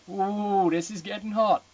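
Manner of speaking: high, loud teasing ooh; gleefully teasing the racy text; mock swoon. Real, not acted. Reader teasing the book, high and loud.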